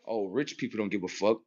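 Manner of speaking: mock their complaint, softly; mocking poor people. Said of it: "them saying".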